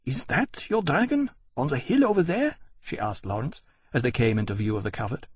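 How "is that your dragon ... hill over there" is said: queen's question. A narrator's reading. Queen asking Lawrence.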